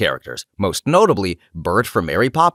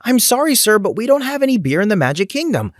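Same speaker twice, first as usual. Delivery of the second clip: much higher, sing-song polite refusal; put-on perky cast-member voice; smiling customer service; 11 semitones higher than usual. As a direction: repeat the apology with a forced high smile. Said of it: "struggling to repeat myself with a smile".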